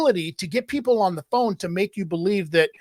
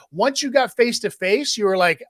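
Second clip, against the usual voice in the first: usual speaking voice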